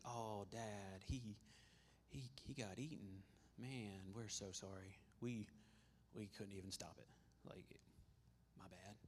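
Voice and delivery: low, hushed, halting mock apology to Dad; feigned regret. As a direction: play it off softly, haltingly, as fake grief. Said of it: "We're just gonna play this off".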